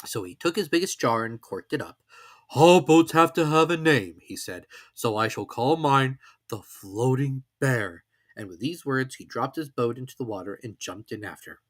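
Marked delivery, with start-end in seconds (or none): 2.5-4.2 slow, louder, higher bear voice; a cosy storybook bear, proud; Pooh voice. A narrator's reading. 4.9-8.0 a cosy storybook bear, proud; Pooh voice. A narrator's reading.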